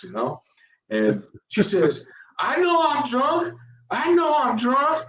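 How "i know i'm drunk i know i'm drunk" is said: very high, rising mock-drunk voice; drunk woman imitation. Drunk woman admitting it to officer, hammed-up tipsy voice. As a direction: play her tipsy and high-pitched. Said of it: "She's drunk".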